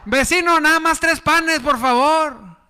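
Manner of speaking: high, sing-song begging call; pleading neighbor. Acted. Neighbor knocking at midnight, calling high and pleading.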